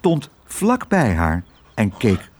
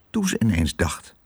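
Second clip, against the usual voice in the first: ordinary delivery, nothing marked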